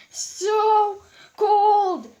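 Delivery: high, loud shivering cry; teeth chattering. Acted. Frisk hugged by icy Sans, loud shivering squeal. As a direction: squeal it out while freezing. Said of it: "her teeth chattering".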